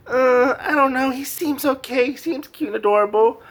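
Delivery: high, put-on wavering voice; hesitant defenders. Everybody defending the dog to head knight, high and hesitant. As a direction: pitch it high and unsure, mildly pleading.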